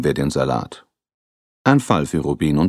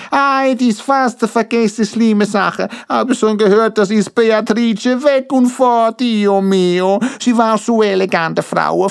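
put-on much higher, louder bubbly Italian accent; Italian-accented Ricardo; 5 dB louder, slower than usual. Grinning Ricardo to the detectives, excited in an Italian accent. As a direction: beam with sudden inspiration in an Italian accent.

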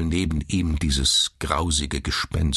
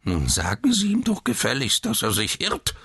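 put-on high, peevish old man's voice; querulous old man; 7 semitones higher than usual. A narrator's reading. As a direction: demand it querulously. Old man complains to nurse. (nörgelnder Alter)

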